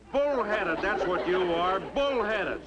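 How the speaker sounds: high, indignant accusation; angry insult. Acted. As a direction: throw the insult back in a heated quarrel. Two fathers quarrel, insult thrown.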